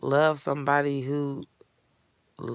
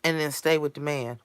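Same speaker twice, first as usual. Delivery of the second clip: ordinary talk, nothing marked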